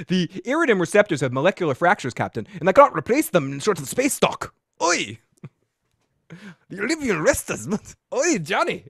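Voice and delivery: fast, light Scottish engineer technobabble; Scottish accent. Retold or imitated. Host does a Scottish engineer's technobabble, Scottish accent. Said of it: "did a Scotty voice".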